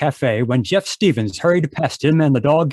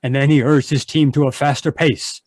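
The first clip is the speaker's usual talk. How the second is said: ordinary talk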